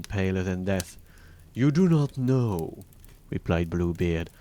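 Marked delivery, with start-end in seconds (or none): 1.5-2.8 slow, louder, higher echo of her words; menacing disbelief. A narrator's reading. Bluebeard repeating his wife's denial, slow menacing disbelief. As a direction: throw it back at her slowly and heavily.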